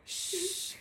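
long voiceless shush; frightened student's imitated hush; scared shushing. Frightened student shushing along, soft drawn-out shush. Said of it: "Bien asustada yo".

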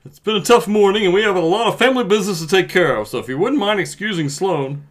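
a touch of a pompous dad; lightly voiced grieving father; stuffy father voice. Reads father role.

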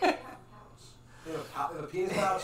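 laughing disbelief. Real, not acted. Incredulous laughing question.

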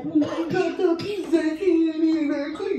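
mocking commenter voice. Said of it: "gonna be in the comments like".

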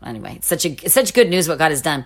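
usual speaking voice

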